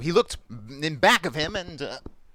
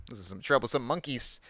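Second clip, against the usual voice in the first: usual speaking voice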